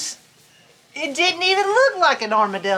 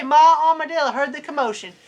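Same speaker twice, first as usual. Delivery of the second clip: ordinary delivery, nothing marked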